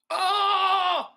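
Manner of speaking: sudden high loud oh of surprise; real startled exclamation; startled shout. Real, not acted. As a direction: yelp at the shock. Host startled by something happening.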